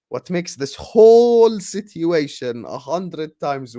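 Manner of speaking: WHOLE stretched long and loud; drawn-out emphasis. Real, not acted. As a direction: drag out "whole" loudly, slowly, for outrage. Building outrage over the referee decision.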